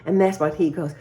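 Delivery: normal talking voice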